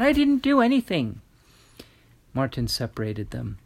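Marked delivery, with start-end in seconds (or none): 0.0-1.2 put-on high, loud child's protest; protesting boy. A narrator's reading. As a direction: cry out your innocence like a frightened boy. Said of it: "the boy cried".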